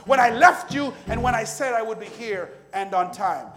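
rising sermon cadence, preaching at full force; building sermon litany. Acted. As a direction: build each "when I" until you're nearly shouting.

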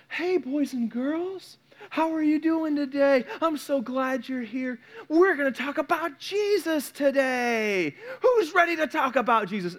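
put-on talking down to tiny children; preschool teacher voice. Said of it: "speak differently to the preschoolers".

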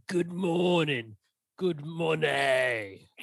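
"Good morning. Good money" drawn out very slowly, every vowel long; stretched out slowly. Shown as a demonstration. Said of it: "it's almost like you're saying".

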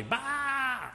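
a lightly voiced, high mock bleat; sheep bleat. Preacher imitating a woman calling her lamb, mock sheep bleat. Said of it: "she tried to sound like a sheep".

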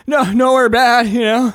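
high, loud, flustered denial; nervous pleading. Acted. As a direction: babble innocence at the cop. Nervous answer to scary cop.

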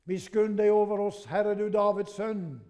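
blind men calling loudly to Jesus for mercy; crying out loud. Acted. Blind men at the roadside crying to Jesus, loud plea. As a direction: raise the voice in a desperate cry. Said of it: "ropte de".

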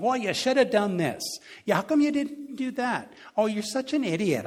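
high, sneering voice of the critic in your head; nagging inner critic. Retold or imitated. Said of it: "You always got that voice".

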